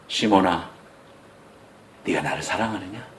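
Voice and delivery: soft, low, gentle asking; tender question. Acted. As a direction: ask him tenderly like a loving master. Jesus asks Peter gently.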